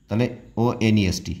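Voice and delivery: ordinary talk, nothing marked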